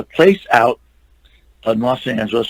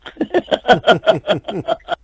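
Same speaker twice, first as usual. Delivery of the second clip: warm chuckle, rising high; laugh; 8 semitones higher than usual. Real, not acted. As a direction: laugh at the memory of having no money. Laughs after the money joke.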